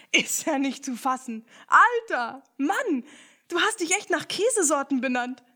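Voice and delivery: loud, high, gleeful teasing; amused outburst. Acted. Ernie teases Picondu about his cheese name, loud and gleeful. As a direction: crack up at the discovery.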